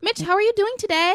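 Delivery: high, rising greeting; upspeak. Shown as a demonstration. Friend mocks upspeak greeting, high and rising. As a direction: greet Mitch in a high, lilting question voice. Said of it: "So, like".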